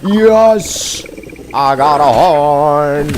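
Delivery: happy gamer scoring loot; triumphant. Real, not acted. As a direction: celebrate the win loudly. Triumph in game.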